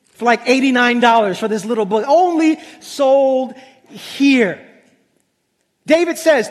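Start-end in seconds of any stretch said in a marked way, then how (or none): 2.1-4.6 drawn out. Retold or imitated. Repeating the website's line mockingly.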